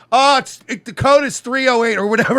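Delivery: loud, high, panicky re-enactment; mock-panicked brush-off; flustered escape. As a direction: blurt it out loudly to get away. Acts out desperate attempt to escape.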